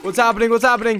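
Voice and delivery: high, loud repeated questions; frantic and rattled; panicked. Real, not acted. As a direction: babble it in alarm. Panic as game glitches.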